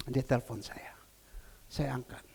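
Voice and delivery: hushed whisper, almost no voice. Acted. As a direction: bisik pelan seperti di tengah malam. Hushed retelling of the early call. (berbisik pelan)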